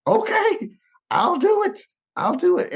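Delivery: very high, frantic mock yielding; panicked surrender. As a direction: give in desperately, in a hammed-up squeal. Acting out panicked surrender to God.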